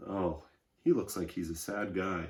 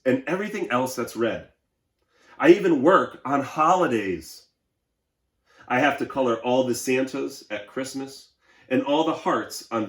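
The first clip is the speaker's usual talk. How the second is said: higher, louder voice of a worn-out crayon complaining; overworked Red Crayon; 6 semitones higher, 13 dB louder than usual. Acted. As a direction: play the exhausted red crayon begging for a rest. Reads Red Crayon's letter aloud.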